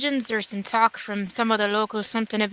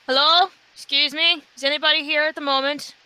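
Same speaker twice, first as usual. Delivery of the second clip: raised, higher-pitched call into a building; 5 semitones higher than usual. Acted. Visitor at a temple calling for whoever is inside, calling out. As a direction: call out politely to see who's around. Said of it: "I'll call out".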